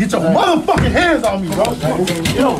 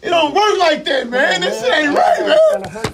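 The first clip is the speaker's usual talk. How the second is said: pitched way up, frantic; panicked high yelling; 8 semitones higher, slower than usual. Real, not acted. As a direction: yell in real panic. Man resisting forced restraint.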